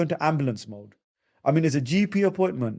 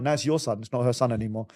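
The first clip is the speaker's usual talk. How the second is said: ordinary talk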